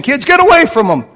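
put-on an annoyed disciple barking at the children; disciples shooing kids. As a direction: shoo the kids off like a fussy disciple.

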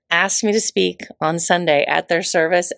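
usual speaking voice

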